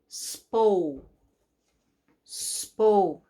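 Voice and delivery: "Spo" with a long oh; long O blend. Shown as a demonstration. Teacher to kids, modelling the long o in "Spo". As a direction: stretch the oh in "Spo". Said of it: "Long O".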